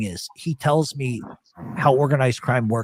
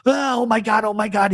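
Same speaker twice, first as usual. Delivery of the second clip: higher, mock-frightened gasp of a friend; imitated panic; 8 semitones higher than usual. Elio running past in the dark, mock panic. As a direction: imitate a spooked friend in the woods. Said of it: "Then I hear".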